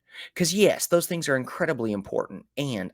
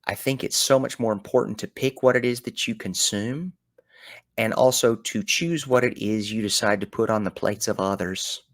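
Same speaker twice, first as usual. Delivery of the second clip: ordinary talk, nothing marked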